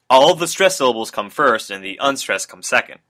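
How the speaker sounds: normal talking voice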